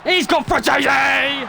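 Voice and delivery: slow, loud, high rising call; excited shout. Real, not acted. Gamer spotting an open striker, loud excited shout. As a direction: build the hype as the ball comes in.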